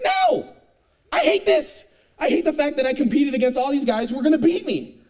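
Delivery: very high, loud furious no; bitter, shrieking protest; angry refusal. Retold or imitated. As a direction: explode at dad's question. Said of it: "I'm mad".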